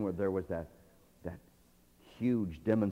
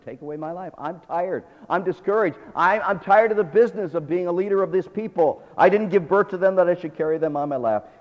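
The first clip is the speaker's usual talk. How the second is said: loud lament; 7 semitones higher, 8 dB louder than usual. Acted. Moses complaining to God.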